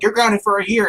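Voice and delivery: lightly voiced scolding dad; retold dad's punishment. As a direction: hand down the sentence as dad. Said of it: "the dad's like".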